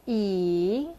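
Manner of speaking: "Yi" dipping low, then rising; third tone. Shown as a demonstration. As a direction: draw out "Yi", down and back up. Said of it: "bring it down and then bring it back up".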